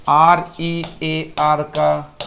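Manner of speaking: slow spelled letters R E A R. Shown as a demonstration. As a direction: read each letter slowly and apart. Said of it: "spelling out rear". (धीरे-धीरे अक्षर बोलना)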